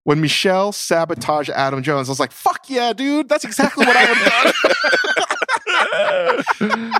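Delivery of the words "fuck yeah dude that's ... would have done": high-pitched reenacted approval, rising as it goes; retold excited cheer. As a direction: cheer them on as if reliving it. Acts out his cheering at the scene.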